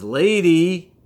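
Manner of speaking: high, loud drawn-out parrot call. Acted. Parrot calling to the woman with the knife, loud. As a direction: sing out her title like a squawking bird.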